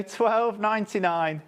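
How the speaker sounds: ordinary talk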